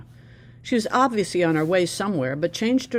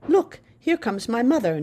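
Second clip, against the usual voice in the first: higher, proud excited call; proud exclamation; 6 semitones higher, 3 dB louder than usual. A narrator's reading. Girl proudly points out her mother arriving, excited. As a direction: announce it proudly. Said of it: "Naledi exclaimed proudly".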